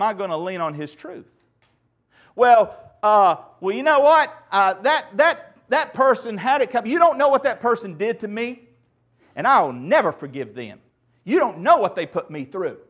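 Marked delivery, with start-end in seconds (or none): 2.3-12.8 loud, higher, stammering protest; hammed-up wounded indignation, pushed up in pitch; indignant objector. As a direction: act out someone defending a grudge. Acting out an unforgiving objector.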